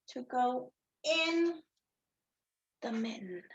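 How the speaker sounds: soft trailing whisper. Acted. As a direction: let it fade to a whisper.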